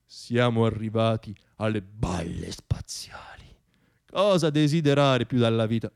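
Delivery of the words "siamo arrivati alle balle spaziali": slow hushed whisper; slow sardonic whisper. Real, not acted. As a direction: murmur it slowly under your breath. Dry aside after absurd promise.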